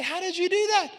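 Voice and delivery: amazed husband asking his wife; amazed disbelief. Retold or imitated. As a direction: pitch jumps way up in astonishment. Amazed at wife's repair.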